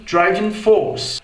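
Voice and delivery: slow British "driving force", no R; British English. Shown as a demonstration. ("driving force" britânico, sem R)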